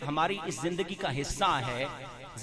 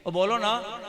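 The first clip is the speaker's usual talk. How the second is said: ordinary delivery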